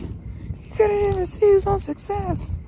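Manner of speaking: high, slow put-on voice of mourners; high, slow mock lament; mock mournful voice. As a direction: play a pitying stranger, high and drawn out. Imagined mourners after his death.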